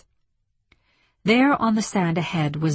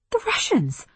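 high-pitched alarm, falling at the end; sudden alarmed realisation, pitched high; alarmed thought; 9 semitones higher than usual. A narrator's reading. Alarmed thought as attack begins.

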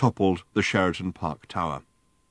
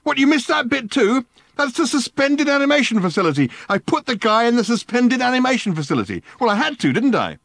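high, loud, manic friend; a wired alien, high and loud; excitable Ford; 5 dB louder than usual. Acted. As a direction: bark orders in a giddy frenzy. Ford's voice held in story.

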